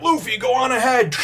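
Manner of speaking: loud, high-pitched call; urgent calling out. Retold or imitated. Fighter calls to his captain mid-duel, urgent shout. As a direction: holler over the clashing swords.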